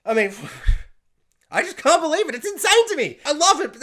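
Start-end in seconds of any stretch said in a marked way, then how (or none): 1.5-3.8 high, loud excited gush; gush, loud and high, overwhelmed; giddy, worked-up fan outburst; delighted disbelief. Real, not acted.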